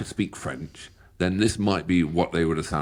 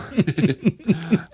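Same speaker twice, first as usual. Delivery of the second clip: high laugh; 12 semitones higher, 4 dB louder than usual. Real, not acted. Laughs at his own joke.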